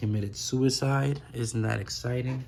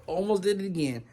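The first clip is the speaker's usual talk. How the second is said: ordinary talk, nothing marked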